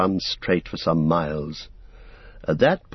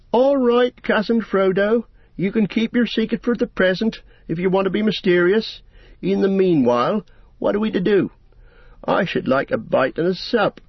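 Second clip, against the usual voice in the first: put-on higher, teasing young hobbit; Pippin's voice; 10 semitones higher than usual. A narrator's reading. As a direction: tease your secretive cousin lightly. Pippin teases Frodo.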